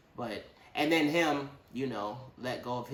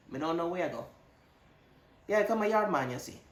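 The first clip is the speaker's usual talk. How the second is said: put-on Jamaican accent; 5 semitones higher, faster than usual. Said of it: "A Jamaican?".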